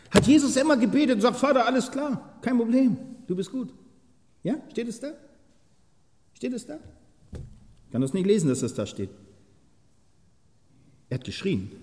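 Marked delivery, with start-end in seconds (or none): 0.1-6.8 high ringing preacher's challenge; preaching at full force. Acted. Preacher to his congregation about prayer, high challenging preaching. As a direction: throw the question at the hall.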